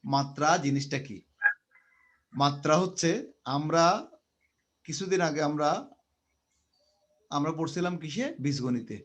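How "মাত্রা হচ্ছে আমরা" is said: slow, each word spaced out; slow, deliberate. Shown as a demonstration. Teacher explaining dimension to students, slowly. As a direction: lay it out slowly so students follow. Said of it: "আস্তে আস্তে বলতেছি".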